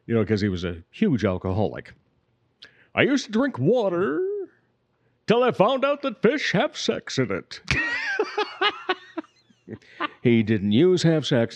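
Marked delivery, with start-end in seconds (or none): 2.9-7.6 put-on high drawling old comedian; old-timey comedian voice; W C Fields. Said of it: "he was also quoted as saying". 7.6-8.9 high giggle. Real, not acted. Laughing at own impression.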